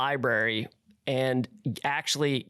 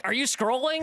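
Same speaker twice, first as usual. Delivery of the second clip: suspicious wife; 10 semitones higher than usual. Retold or imitated. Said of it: "she'd be like".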